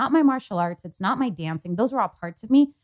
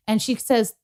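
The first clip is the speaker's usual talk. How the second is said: ordinary talk, nothing marked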